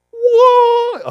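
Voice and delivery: sudden high startled whoa. Retold or imitated. Recalling the troopers' flashlight, a startled whoa. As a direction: jump at the blinding light.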